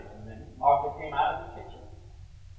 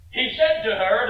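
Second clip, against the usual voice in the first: ordinary talk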